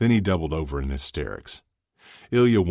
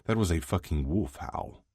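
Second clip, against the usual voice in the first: usual speaking voice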